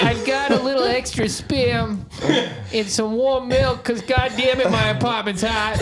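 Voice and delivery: put-on high, whiny old lady voice; a kvetching New York grandma. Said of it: "that voice in my head".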